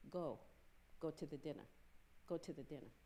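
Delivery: low, soft insistent urging; a quiet voice that won't let go; nagging inner voice. Retold or imitated. God's voice nagging him to the dinner, low and soft.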